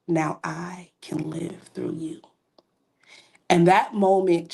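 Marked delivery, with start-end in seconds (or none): 0.1-2.2 slow, unvoiced whisper. God answering her surrender, a slow hushed whisper. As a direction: let God's answer come slow and hushed. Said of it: "He said".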